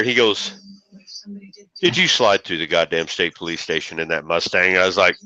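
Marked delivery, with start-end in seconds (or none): 1.8-4.7 stern dad. Retold or imitated. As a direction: play the suspicious father, dropping the voice low. Dad confronting son about paper.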